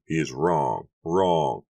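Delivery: restrained outcry with a forceful finish; insistent cry. A narrator's reading. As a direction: cry it out, hammering the repeated word. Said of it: "he cried".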